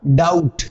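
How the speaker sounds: डाउट as "dout", falling; correct doubt. Shown as a demonstration. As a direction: say डाउट with the B silent. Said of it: "इसका सही उच्चारण क्या होगा". (डाउट, B silent सही उच्चारण)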